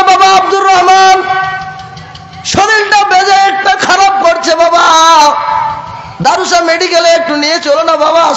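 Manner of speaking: high plaintive sermon voice; pleading preaching. Acted. Sick old father begs his son Abdur Rahman, high pleading sermon.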